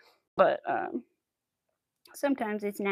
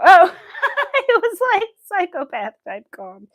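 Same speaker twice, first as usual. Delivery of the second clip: high, loud, through laughter; laughing; 7 semitones higher, 11 dB louder than usual. Real, not acted. Streamer jokes about her own calm, laughing. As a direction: let real laughter carry the words up.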